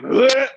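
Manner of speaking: a sudden high, loud retching "bleh"; mock gag. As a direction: gag as if the smell just hit you. Gagging at the dead man's smell.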